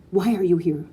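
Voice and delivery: loud, accusing question. A narrator's reading. Father confronting his wife, loud and accusing. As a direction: throw it at her like a charge. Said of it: "more like an accusation".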